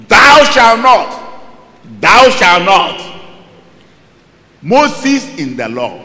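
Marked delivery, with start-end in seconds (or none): 0.1-3.0 slow shouted commandment, word by word; slow booming law; slow loud command. Acted. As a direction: drag out each word as a stern decree. Preacher voicing the law's command.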